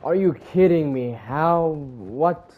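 loud, high, incredulous outburst; real exasperated yelling; outraged disbelief. Real, not acted. Gamer finds the enemy leading, incredulous shout.

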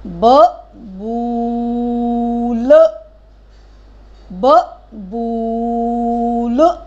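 बबूल slow, ऊ held long. Shown as a demonstration. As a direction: model बबूल slowly. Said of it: "क्या होता है?".

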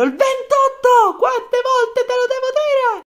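high, slow, exasperated yell; furious shout. Acted. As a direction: blow up at him. Colonel loses his temper.

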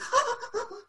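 high, breathy gasping intakes; imitated sobbing gasps. Stylist showing client's reaction, high mock sobbing gasps. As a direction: imitate someone gasping into tears. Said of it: "she does one of these".

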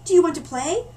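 a bright, curious cub asking; friendly panda voice. Acted. Panda cub greeting the newcomer, eager and high. Panda invites polar bear.

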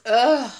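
loud guttural ugh at the sting; loud groan of disgust. Real, not acted. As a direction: groan as the fumes hit. Reacting to strong onion fumes.

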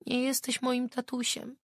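put-on high, crying little girl's voice; small girl crying the words; crying child. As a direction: cry it as a frightened child. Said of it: "płakała".

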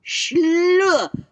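high, loud troll snort of denial. A narrator's reading. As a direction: brush it off like an annoyed troll. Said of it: "sagde trolden".

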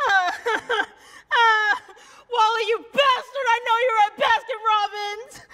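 high, loud curse through labor pain; angry pained yell. Acted. Woman in labor cursing her absent husband, angry pained yell. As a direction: yell furiously at someone who is absent.

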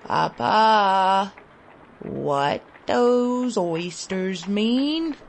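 put-on weak child pleading again, slowly; child repeating question. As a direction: drag the question out, faint and hungry. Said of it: "I repeated".

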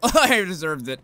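loud, real laughter through the words; laughing guiltily. Real, not acted. Gamer admits deserving hell, laughing guiltily. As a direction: confess while cracking up. Cracks up at own cruelty in game.

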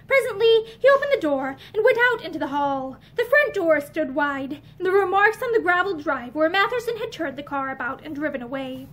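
plain reading voice

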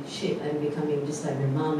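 normal talking voice

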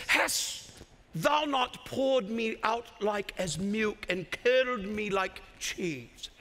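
lightly solemn, slow scripture voice; a hint of archaic preacher; King James reading. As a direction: read it like old scripture, drawn out. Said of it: "I'm actually reading from the King James".

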